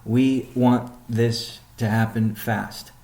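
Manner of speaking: normal talking voice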